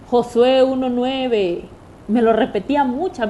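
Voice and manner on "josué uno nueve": slow, louder, measured man's voice from a dream; dream messenger voice. Retold or imitated. A man in her dream tells her the verse, slow and louder. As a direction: spell out the verse slowly like a voice in a dream. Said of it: "un señor que me decía".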